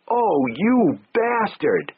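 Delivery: high, slow tearful cry. Acted. Slapped wife cries at husband, high slow tearful voice. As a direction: let the tears break into the words. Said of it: "tears were brimming in her eyes".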